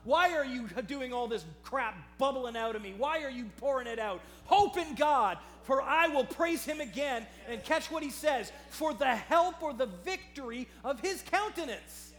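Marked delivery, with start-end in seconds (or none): 0.0-7.3 loud, high, emphatic preacher's rebuke; commanding self-rebuke. Acted. David telling his soul to stop and hope, commanding self-rebuke. 8.7-12.1 loud, raised preaching voice; emphatic proclamation. Acted. Preacher quoting David's hope to the congregation, emphatic proclamation.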